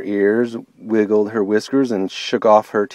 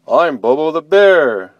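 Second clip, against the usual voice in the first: louder bear voice, a touch perturbed; 5 semitones higher, 6 dB louder than usual. Acted. Bobo the bear telling bunny his name, perturbed. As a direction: grumpily introduce yourself as Bobo. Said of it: "the voice sounded perturbed".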